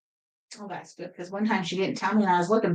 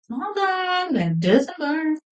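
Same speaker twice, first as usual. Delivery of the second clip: laughing it out slowly, higher; a slow, amused repeat through laughter; 7 semitones higher, much slower than usual. Real, not acted.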